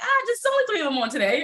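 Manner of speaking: high, quick playfully coy answer; playful reply. Retold or imitated. Answering her teasing clients, high and playfully coy. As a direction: act sheepish and playful. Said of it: "And I'm like".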